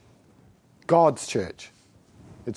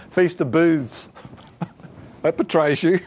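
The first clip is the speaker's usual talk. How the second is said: laughing; 2 semitones higher than usual. Real, not acted. Laughing at own joke.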